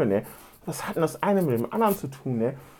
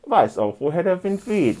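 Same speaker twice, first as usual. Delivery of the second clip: ordinary talk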